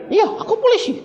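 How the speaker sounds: policeman. Retold or imitated. Policeman nods and answers.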